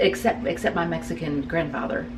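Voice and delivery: ordinary delivery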